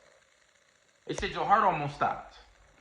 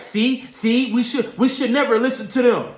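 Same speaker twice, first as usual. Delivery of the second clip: high, louder scolding voice; scolding friends; 8 semitones higher, 5 dB louder than usual. Retold or imitated. Friends arguing over him, high and loud. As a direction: scold the others for listening. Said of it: "That's what I heard them saying".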